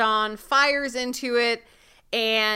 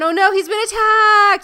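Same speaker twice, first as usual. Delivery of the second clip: higher and louder, hammed-up gasp of alarm; mock alarm; 9 semitones higher, 9 dB louder than usual. Retelling the episode to her co-host, hammed-up alarm. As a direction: play up a fake shock.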